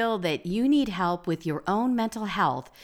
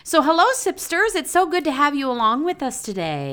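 bright greeting; 9 semitones higher, 4 dB louder than usual. Real, not acted.

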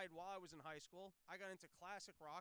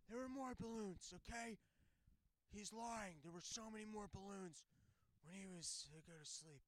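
Biden impression. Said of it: "and then Biden's".

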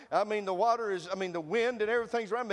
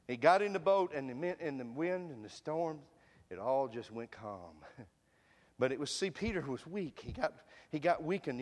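ordinary delivery

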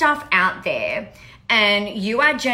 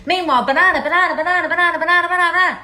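higher, louder chant of banana, banana as if pelting a crowd; mock banana barrage; 8 semitones higher, 5 dB louder, much faster than usual. Mocking marketers who pelt everyone, a louder, higher banana chant. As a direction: hammer out banana over and over, rising and loud.

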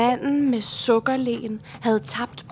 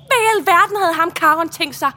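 højt, hævet toneleje; forarget udbrud; 6 semitones higher, 7 dB louder than usual. Acted. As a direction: udbryd det i vantro forargelse. Forarget udbrud over båden. (højlydt forarget)

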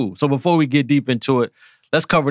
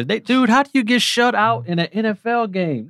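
can't believe they got shut out; disbelief; 11 semitones higher than usual. Real, not acted. Disbelief at the shutout.

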